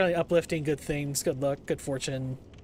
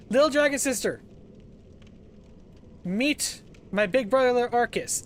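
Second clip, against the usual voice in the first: sing-song streamer introduction; cute anime streamer; 6 semitones higher, 4 dB louder than usual. Real, not acted. Introducing friend.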